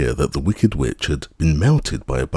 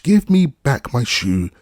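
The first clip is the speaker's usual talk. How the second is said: put-on high, louder demand from an angry little girl; indignant child; 9 semitones higher, 4 dB louder than usual. A narrator's reading. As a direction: stamp your foot and demand it back. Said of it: "grew angry and said to the witch".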